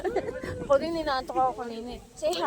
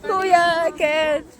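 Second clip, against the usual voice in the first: laughing call; 6 semitones higher, 6 dB louder, much slower than usual. Real, not acted.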